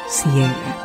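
ordinary delivery

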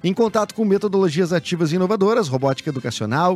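upbeat radio ad read. Acted. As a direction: read it like a sponsor spot. Reads sponsored ad.

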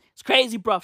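ordinary delivery

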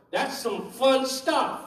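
ordinary talk, nothing marked

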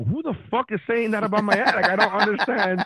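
high, incredulous protest; comically outraged, pitch jumping up; shocked. Retold or imitated. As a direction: blurt it out in baffled disbelief. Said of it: "so shocked at the setup".

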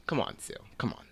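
breathy whisper, no voice; whispering at Sue in exasperation; whispered plea. Real, not acted. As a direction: hush it like a fed-up aside.

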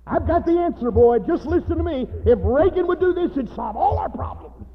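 high, loud, eager outburst; excited shouting. Acted. Eager man pitching his answer to everybody, excited shouting. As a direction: burst out with the big answer.

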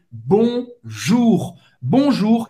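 "bonjour" com R na garganta, nasal no início; French bonjour. Shown as a demonstration. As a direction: diga "bonjour" bem francês. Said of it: "Eu já falei". ("bonjour" pronunciado à francesa)

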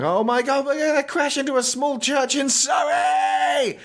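very high, squealing comic shout; hammed-up wail of impending doom; mock screaming panic. Tom as the plane plummets, mock screaming panic.

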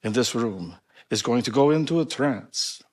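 put-on grand showman with a Polish accent. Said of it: "this is my Polish accent".

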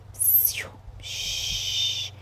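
soft unvoiced shush. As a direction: hush them like a hare. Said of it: "sussurrou a lebre".

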